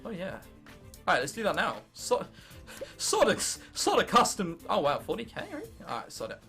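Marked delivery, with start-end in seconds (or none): none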